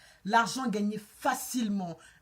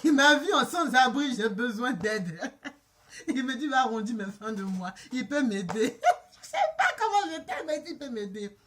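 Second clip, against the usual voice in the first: laughing; 6 dB louder than usual. Real, not acted. Storyteller mocking how she was mistaken for homeless, laughing. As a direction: talk through bursts of laughter. Cracking up at her own misfortune.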